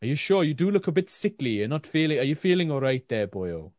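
put-on lilting, botched Welsh accent; attempted Welsh accent. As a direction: play a concerned local with a makeshift Welsh accent. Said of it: "it was my attempt at Welsh".

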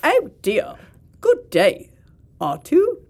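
put-on fussy droid with an Australian accent; prim, high robot butler gone Aussie; C-3PO. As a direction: do a prissy droid in mock Australian. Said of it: "What if C three P O had an Australian accent?".

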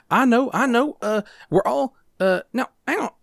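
high, loud dithering character voice; hesitant character, high and forceful; flustered game character. Acted.

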